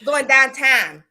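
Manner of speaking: broad Pittsburgh drawl on "downtown"; Pittsburgh accent. Shown as a demonstration. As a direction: Pittsburghese "Going downtown", stretched out. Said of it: "Pittsburgh ... ugliest accent".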